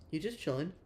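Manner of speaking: usual speaking voice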